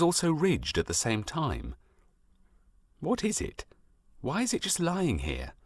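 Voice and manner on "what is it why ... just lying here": put-on higher, softer voice of a puzzled five-year-old; wondering little boy. A narrator's reading. Jack puzzling over the stone he found, higher and softer, wondering. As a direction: let the boy wonder aloud, high and hushed. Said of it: "wondering so".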